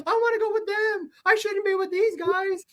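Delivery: arrested lookalike begging to join others, whiny; whining plea. Retold or imitated. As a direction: beg like a scared bystander. Crying lookalike begging police.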